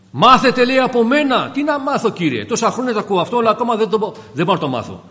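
high-pitched outburst, loud at the start; impassioned preaching. Real, not acted. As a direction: launch it loudly, then rush on pleading. Preaches at full force.

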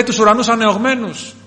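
acted martyr's proclamation; high, loud cry of vision. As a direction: imitate a martyr calling out. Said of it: "Και φώναζε και έλεγε".

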